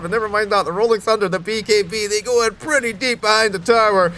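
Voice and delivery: high, loud, excited esports casting; live play-by-play. Acted. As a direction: call the teamfight at full hype.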